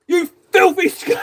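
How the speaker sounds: high shouted hammed-up insult; mock sergeant insult. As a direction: spit it out like a cartoon drill sergeant. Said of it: "Table, stop".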